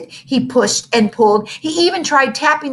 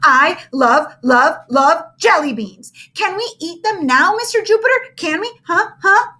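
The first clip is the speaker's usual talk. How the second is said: eager begging; 8 semitones higher, 5 dB louder than usual. Acted. Ham begs for candy.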